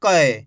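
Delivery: teacher stressing the ai sound; forceful ai. Shown as a demonstration. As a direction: punch कै hard. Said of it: "जोर लगा के पढ़ेंगे".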